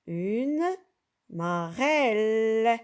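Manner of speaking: "marelle" stretched slowly, syllable by syllable; "marelle" drawn out with an open "è"; slow model word. Shown as a demonstration. Teacher to pupils, introducing the word: "marelle" slow and clear.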